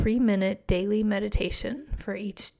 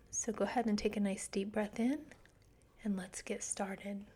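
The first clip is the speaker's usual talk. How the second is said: guided meditation; 4 dB quieter than usual. Acted. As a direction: guide them gently into calm.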